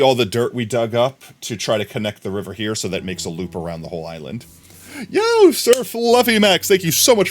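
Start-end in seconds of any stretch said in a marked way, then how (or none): 5.1-7.3 excited. Real, not acted. Greeting a new follower.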